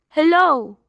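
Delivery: usual speaking voice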